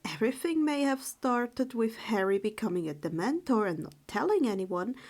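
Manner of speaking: ordinary delivery, nothing marked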